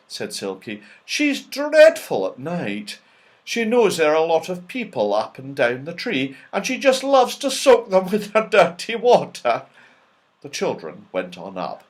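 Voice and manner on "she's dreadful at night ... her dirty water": fairy's warning. A narrator's reading. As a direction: warn with a half-laughing lilt. Silky warns about Dame Washalot.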